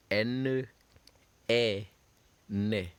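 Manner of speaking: N, A, "na" spelled then said as a syllable; French syllable drill. Shown as a demonstration. Teacher models French syllables for students to repeat. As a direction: sound out N, A and then "na" slowly for the class. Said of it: "And the last".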